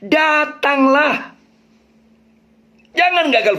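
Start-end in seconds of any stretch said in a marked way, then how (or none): 0.0-1.4 higher, slow "Datanglah"; emphatic come. Shown as a demonstration. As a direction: lift and stretch "Datanglah". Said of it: "Maka penekannya".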